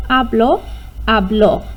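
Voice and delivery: habló with the stress landing hard on ló; stress on the last syllable. Shown as a demonstration. Teacher modelling the accent for pupils, stressing the end. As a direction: punch the final ló of habló. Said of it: "that's where the oomph is".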